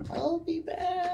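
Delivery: high, breathy ghost whisper; a faint spectral whisper from beyond; ghostly whisper. Acted. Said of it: "you hear a faint whisper".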